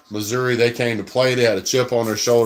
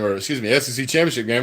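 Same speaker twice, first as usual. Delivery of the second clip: normal talking voice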